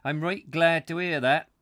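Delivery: put-on amateur northern English accent. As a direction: belt it out as a broad northerner. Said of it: "I'm probably killing the accent".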